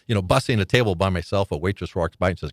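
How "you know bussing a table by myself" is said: ordinary delivery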